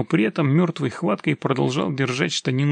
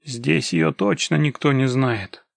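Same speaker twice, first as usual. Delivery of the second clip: usual speaking voice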